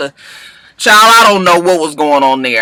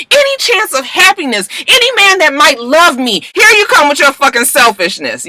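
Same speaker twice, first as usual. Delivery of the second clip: higher, rising furious rant; imitating an angry outburst; 10 semitones higher than usual. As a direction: hurl the accusations at her sister in mock fury. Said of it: "She was just like".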